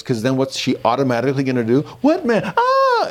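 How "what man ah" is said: high-pitched startled question into a scream; startled child. Acted. Dad imagines his small daughter spotting the man, startled shriek. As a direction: mimic a frightened little girl crying out.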